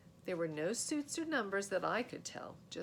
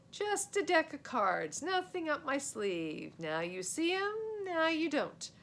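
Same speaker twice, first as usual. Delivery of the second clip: showman magician; 9 semitones higher, 6 dB louder than usual. Acted. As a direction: play the big-time magician to the court. Boy performing magic trick.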